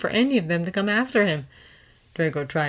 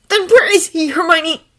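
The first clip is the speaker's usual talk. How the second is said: higher, loud bitter demand; young man hissing a bitter retort at his friend; hissed; 12 semitones higher, 10 dB louder than usual. A narrator's reading. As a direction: throw the question back in anger. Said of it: "Draco hissed".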